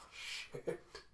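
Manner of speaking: real hushed swear on a sigh; sighed whisper. Real, not acted. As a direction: let it out under your breath, voiceless. Breaks off narration to react.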